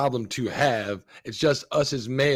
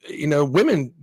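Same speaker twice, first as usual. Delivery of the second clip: ordinary talk, nothing marked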